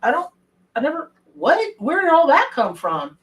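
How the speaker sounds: stunned at all the calls; a disbelieving what; baffled surprise. Retold or imitated. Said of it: "And I'm like".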